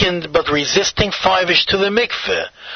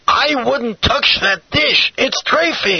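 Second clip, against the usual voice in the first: mild narrated shout; 8 semitones higher than usual. A narrator's reading. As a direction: exclaim it high, as a storyteller's shout. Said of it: "he shouted".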